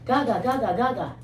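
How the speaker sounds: 가가 in a light Gyeongsang dialect, falling. Shown as a demonstration. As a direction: repeat 가가 in a mild Gyeongsang dialect. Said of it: "경상도에 남아있는 사투리야". (경상도 사투리로 가볍게 가가)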